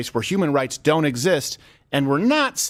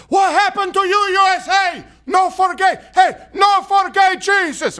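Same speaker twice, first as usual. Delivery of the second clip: put-on heavy Cuban accent, high loud shout; bellowed imitation in a thick Cuban accent; Yoel Romero; 6 dB louder, slower than usual. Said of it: "in this heavy accent".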